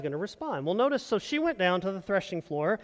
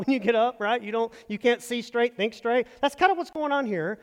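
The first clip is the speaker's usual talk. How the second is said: normal talking voice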